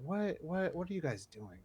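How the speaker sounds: quiet, baffled muttering at the crew; half under his breath, stunned; exasperated disbelief. Retold or imitated. Exasperated quote of himself on set.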